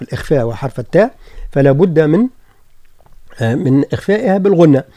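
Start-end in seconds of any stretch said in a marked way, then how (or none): none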